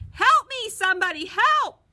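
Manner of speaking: very high, loud terrified yelling; panicked scream. A narrator's reading. Bully Marty screams in panic about the mouse. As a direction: scream for your life.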